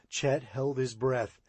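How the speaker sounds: ordinary delivery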